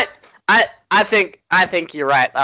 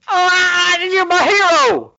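starstruck fan; 5 dB louder, slower than usual. Pretend. Thrilled fan meets hero.

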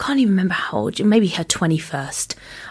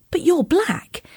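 high, stunned retold reply; shocked; 10 semitones higher, slower than usual. Confronting the date who differs from his photos, shocked. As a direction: blurt it in pitched-up disbelief. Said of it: "I'm quite shocked".

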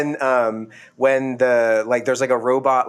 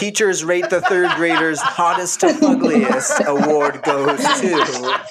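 mock award announcer; 2 semitones higher, slower than usual. Host presenting the hottest to fuggliest award, mock grandly through laughs. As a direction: pretend presenter holding back laughter.

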